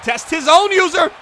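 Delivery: loud, high, drawn-out shout; excited play call. Real, not acted. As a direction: shout the big play as it happens. Live call of big play.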